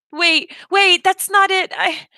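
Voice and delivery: flustered. Acted. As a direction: rush to stop him crying. Hurrying to stop child crying.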